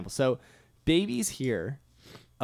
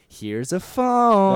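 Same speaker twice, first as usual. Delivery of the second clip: put-on high, slow, swooping baby talk; wide pitch range; 7 semitones higher, 8 dB louder, much slower than usual. Shown as a demonstration. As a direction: move the pitch with the phone. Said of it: "I'm gonna pick up my phone and, like, do it right now".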